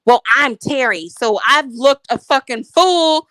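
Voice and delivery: usual speaking voice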